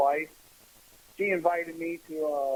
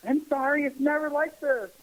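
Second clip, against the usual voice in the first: higher-pitched imitation of an apologizing girlfriend; apologetic girlfriend; 8 semitones higher than usual. Girlfriend to new boyfriend about her family, imitated embarrassed apology. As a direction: lift the pitch for a pleading mock apology.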